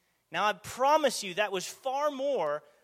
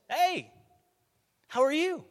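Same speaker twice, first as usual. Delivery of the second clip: bright, higher friendly hello; Jesus greeting the woman at the well kindly, friendly and higher; 8 semitones higher than usual. Retold or imitated. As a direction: greet her warmly, like a kind stranger. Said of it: "something like this".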